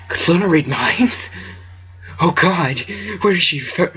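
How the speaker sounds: ordinary delivery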